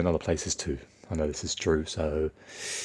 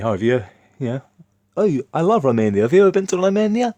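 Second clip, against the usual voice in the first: higher, louder mock Romanian accent, eager; 10 semitones higher, 7 dB louder, faster than usual. Romanian coworker asking if he has visited, in a Romanian accent. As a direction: imitate the Romanian guy's accent, keen to share. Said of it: "I'll do it in his accent".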